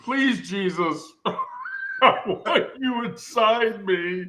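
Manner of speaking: slow, high, hammed-up plea through laughter; mock-devout begging, giggly; mock pleading. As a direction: draw out a joking prayer while cracking up. Mock plea to Jesus, cracking up.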